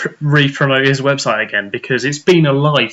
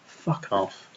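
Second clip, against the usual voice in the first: usual speaking voice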